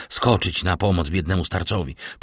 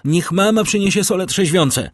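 much higher, louder, frantic request; urgent call; 11 semitones higher, 5 dB louder than usual. A narrator's reading. Valentine calls for smelling salts, frantic. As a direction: shout the request down the stairs. Calling down the stairs.

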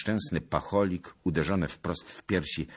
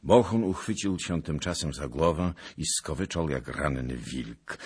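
ordinary delivery, nothing marked

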